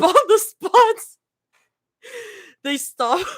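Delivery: high, loud laugh on words. Real, not acted. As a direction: crack up saying it. Laughs mid-sentence.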